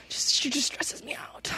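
weary, slow whisper; a tired whispered confession. Real, not acted. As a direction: breathe it out like you're exhausted. Weary aside about ex-friend.

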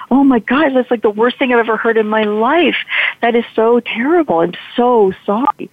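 high-pitched, appalled reaction; retold shocked sympathy. Driver reacting to the boy's awful story, retold shocked sympathy.

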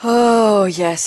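ordinary delivery

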